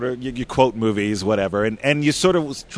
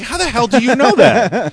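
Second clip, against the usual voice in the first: incredulous; 11 semitones higher, 4 dB louder than usual. Retold or imitated. Amazed at Cully's answer.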